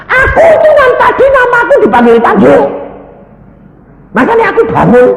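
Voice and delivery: very high, loud, rapid yelling; hammed-up full-voice protest; acted indignant shout. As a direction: holler it like an insistent patient. Patient protests to the nurse.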